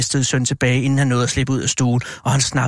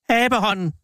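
narrator's contained version of a wild scream; wild cry; 8 semitones higher than usual. A narrator's reading. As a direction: cry it out high, falling at the end. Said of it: "skreg hun vildt".

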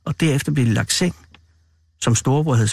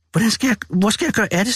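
raised pitch, lightly stammered restart; stammering; 6 semitones higher than usual. A narrator's reading. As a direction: stumble over the start of the question. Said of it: "stammede storebror". (let stammende og rådvild)

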